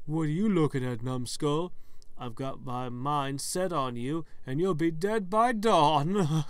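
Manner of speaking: loud, taunting moose-head villain; menacing talking moose head. Acted. Stuffed moose head threatening the player, villainous taunt. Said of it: "rolls its eyes and speaks to you".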